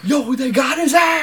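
retelling a friend's shout; mock excited shout. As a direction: yell it like a hyped kid. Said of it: "Kevin was like".

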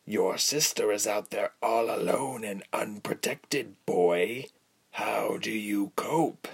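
rough half-whispered menace; coarse villain, taunting. A narrator's reading. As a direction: taunt the captive without hurry. Villain taunts the captive boy.

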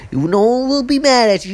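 usual speaking voice

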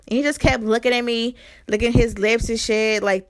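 usual speaking voice

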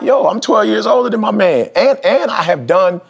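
high, slow insistence on seniority; retold boast, pitched high; defensive pride. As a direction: claim your experience proudly. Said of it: "I'm like".

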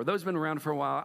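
ordinary talk

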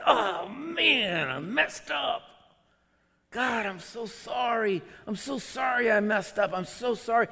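very high, pleading apology; anguished regret. Acted. Believer after a rooster crow moment, anguished apology to God. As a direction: wail the apology in acted despair. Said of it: "And you feel disqualified".

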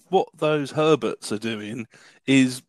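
ordinary delivery

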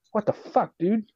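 high-pitched exasperated disbelief; imitated appalled outburst; incredulous reaction. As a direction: retell baffled amazement at a reckless buddy. Retold reaction to reckless sergeant.

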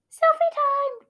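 excited squeal. Acted. Character in comic.

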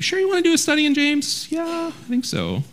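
high, softly doubtful congregant voice; mock hesitant reply; hesitant listener. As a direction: pitch it up and let the answer drag out, unsure.

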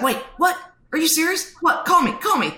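high, excited disbelief, pitch jumping up; playing the husband who can't believe the news; retold stunned surprise. Husband reading his wife's text, in high excited disbelief.

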